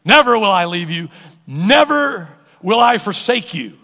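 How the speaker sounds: loud, punched NEVER; emphatic promise. Shown as a demonstration. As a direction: proclaim it, hitting each NEVER hard. Repeats the promise with force.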